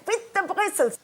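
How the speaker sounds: high-pitched imitation of an insistent mum; obsessive mother. Mother drawing it in the tablecloth, high mock insistence. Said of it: "she'd draw it in the".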